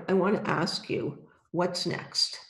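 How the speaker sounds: ordinary talk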